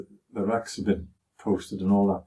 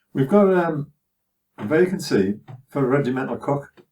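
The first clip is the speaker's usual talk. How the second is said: officer's voice; 6 semitones higher, 4 dB louder than usual. Retold or imitated. Officer at orders.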